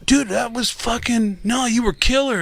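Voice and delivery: high, gushing praise; high-pitched mock gushing; excited reassurance. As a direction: gush like an overexcited fan. Band table reassuring him loudly.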